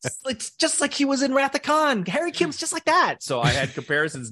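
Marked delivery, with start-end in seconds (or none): 0.0-3.2 much higher, excited fan voice; mock fan gushing, pitched way up. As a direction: squeal the comparison like a thrilled fan. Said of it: "they were thinking".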